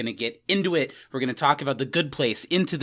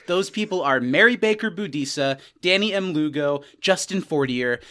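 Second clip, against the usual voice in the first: ordinary talk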